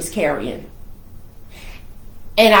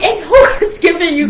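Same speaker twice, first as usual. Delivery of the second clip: laughing disbelief; 9 semitones higher, 6 dB louder, faster than usual. Retold or imitated. Shocked at his guns.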